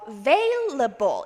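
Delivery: "available" split as a, VAIL, a-ble; syllable by syllable. Shown as a demonstration. Teacher showing how to read it, broken into parts. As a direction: sound out "available" slowly with voiced V. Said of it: "이렇게 읽어주시면 됩니다".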